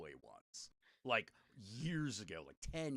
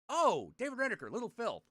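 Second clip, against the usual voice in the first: recognizing; 9 semitones higher, 7 dB louder than usual. Retold or imitated. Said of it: "He's like".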